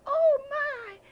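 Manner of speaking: high, loud gasp of fright; high, loud startled little girl. Acted. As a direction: squeal in sudden fright. Goldilocks wakes to bears.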